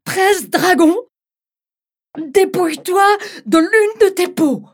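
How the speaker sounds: high, loud bride's command. Acted. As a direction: order the dragon boldly. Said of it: "répondit-elle".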